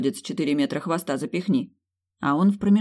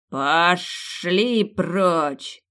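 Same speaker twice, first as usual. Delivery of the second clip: very slow, drawn-out bark; slow monstrous roar; roaring serpent; 2 semitones higher, much slower than usual. Acted. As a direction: bellow it like a giant snake. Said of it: "рявкнул гигантский чёрный змей".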